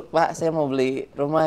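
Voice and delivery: mock eager buyer voice; posing as a buyer. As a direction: play an eager house buyer on the phone. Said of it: "ngebohongin orang".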